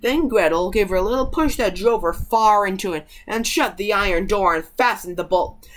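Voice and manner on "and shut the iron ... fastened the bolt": ordinary delivery, nothing marked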